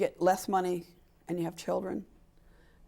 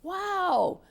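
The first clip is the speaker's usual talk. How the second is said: admiring praise; 9 semitones higher, 4 dB louder, much slower than usual. Retold or imitated. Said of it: "I say".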